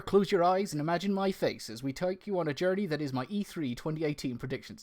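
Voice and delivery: put-on American accent slipping towards Irish; badly faked American accent; botched American accent. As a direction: try American and drift into Irish or West Country. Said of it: "It's closer to what Indi-, uh, like, Irish".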